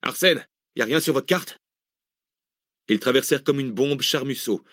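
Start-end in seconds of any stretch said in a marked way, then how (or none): 0.0-1.6 higher-pitched, urgent question; panicked. A narrator's reading. Driver asking map reader for help, panicked. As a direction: plead for a way out, pitch raised. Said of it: "sans dissimuler sa panique".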